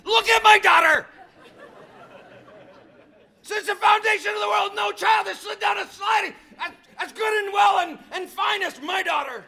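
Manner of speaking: very high, loud outraged shouting; furious father. Retold or imitated. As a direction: yell it as a dad's hammered-up furious rant. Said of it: "I'm just growing furious".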